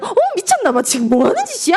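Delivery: high, playful mock outrage; mock scolding. Wife reacting to her husband's silly flirting, mock scolding.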